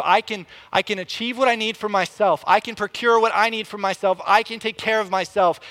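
high, insistent acted claim; self-sufficient insistence. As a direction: insist you need nobody. Voicing a self-sufficient person's insistence.

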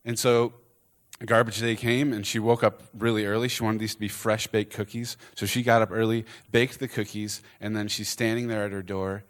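ordinary talk

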